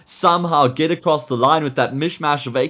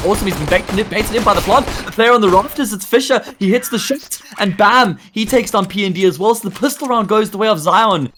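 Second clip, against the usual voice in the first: higher, faster hype call; excited play-by-play; 9 semitones higher, 3 dB louder than usual. Real, not acted. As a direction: ride the action up. Caster calls clutch play excitedly.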